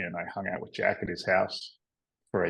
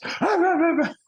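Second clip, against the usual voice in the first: tearful laugh; 6 dB louder than usual. Retold or imitated. Tearful laugh after crying.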